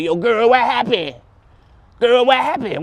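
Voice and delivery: high mock AI voice asking girl what happened; high put-on AI sidekick voice; sassy AI voice.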